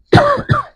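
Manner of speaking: sharp dry cough; a single harsh cough. Real, not acted. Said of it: "Desculpa a tosse aí". (tosse seca)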